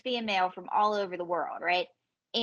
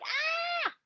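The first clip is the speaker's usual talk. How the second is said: very high mock squeal; short, thin squeal of delight; excited squeal; 4 dB quieter than usual. Comedian reacting to South African fans, high excited squeal.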